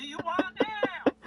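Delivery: high, loud, slow whiny complaint; hammed-up annoyed yell; mock exasperated snap. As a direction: whine it as a fed-up cop. Cop voiced, exasperated.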